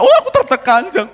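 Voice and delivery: high, loud mock wail of distress; distressed woman. Wife complaining to her friend, hammed-up high wail. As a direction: wail like she can't take it anymore.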